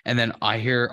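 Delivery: ordinary talk, nothing marked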